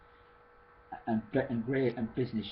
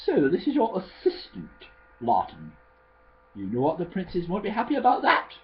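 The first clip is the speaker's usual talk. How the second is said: lightly voiced posh speaker, higher and louder; 6 semitones higher, 5 dB louder than usual. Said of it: "Kurda spoke in quite a posh voice".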